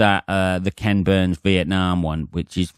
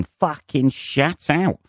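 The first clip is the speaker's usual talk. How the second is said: jokey radio-presenter voice, pitched up high; mock radio voice; 7 semitones higher, slower than usual. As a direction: put on a hammed-up, high radio voice. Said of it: "he says in his, uh, best radio voice".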